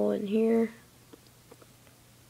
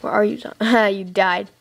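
ordinary talk, nothing marked

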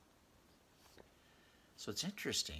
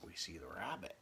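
whisper; 6 semitones lower, 8 dB quieter than usual. Real, not acted. Pointing out the hidden rabbit, whispered. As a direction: whisper it like a secret find. Whispered discovery in border picture.

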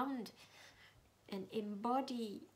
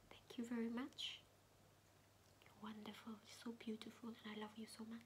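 meditation whisper; 9 dB quieter than usual. Acted. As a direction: whisper gently, guiding inward.

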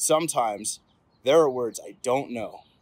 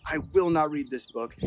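usual speaking voice

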